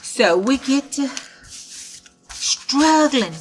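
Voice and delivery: ordinary delivery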